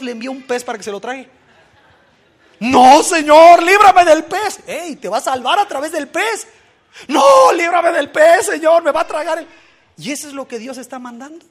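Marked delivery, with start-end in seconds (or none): 2.6-4.6 loud, high, slow desperate plea; hammed-up panicked prayer; pleading cry. Jonah begs not the fish. 7.1-9.5 hammed-up terrified plea; panicked shout. Jonah begs again, shouting.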